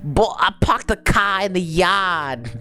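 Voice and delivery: high, slow Boston caricature; Boston accent. Shown as a demonstration. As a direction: stretch it out in exaggerated Boston. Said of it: "That's my Boston accent".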